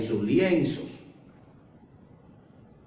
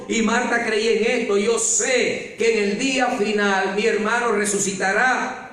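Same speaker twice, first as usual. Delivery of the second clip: raised, higher preaching voice voicing Marta's faith; preaching at full force; 10 semitones higher than usual. Preacher proclaiming Marta's faith to the congregation, fervent preaching.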